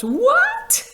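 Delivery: a high, loud, startled shriek with a laugh; shocked squeal. Real, not acted. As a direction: squeal in real disbelief, laughing. Hears she was a sleep playlist.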